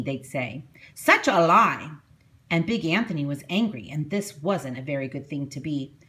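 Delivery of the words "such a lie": louder scornful jeer; mocking townsfolk. A narrator's reading. Townspeople jeer at Big Anthony, loud and scornful. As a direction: scoff at his silly story.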